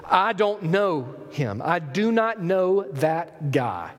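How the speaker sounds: very slow, every word weighed; slow and emphatic, hitting "not"; deliberate, drawn-out sworn denial; solemn oath. Acted. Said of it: "a solemn promise before God".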